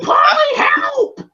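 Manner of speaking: high, loud desperate yell for help; panicked cry to his friend; Rocky crying for help. Retold or imitated. Rocky being choked, screaming to Paulie for help, high and loud. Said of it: "you hear Rocky go".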